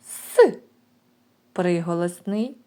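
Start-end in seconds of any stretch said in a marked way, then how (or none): 0.1-0.6 "С" hissed out high and loud as a single isolated sound; sounding out the letter. Shown as a demonstration. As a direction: hold a sharp, loud hiss on "С" for the children. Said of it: "Перший звук".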